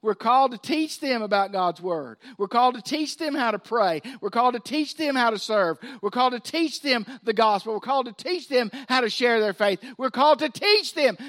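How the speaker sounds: building, rhythmic preacher's refrain; rising preacher's cadence, hammering each phrase; preaching. Acted. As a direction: drive the repeated line home like a sermon.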